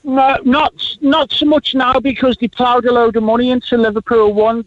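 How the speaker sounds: usual speaking voice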